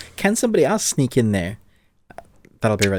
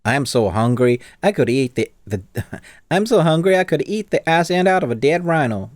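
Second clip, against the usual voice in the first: hammed-up American accent turning Southern, higher pitched; 5 semitones higher than usual. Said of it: "That, that is my American accent".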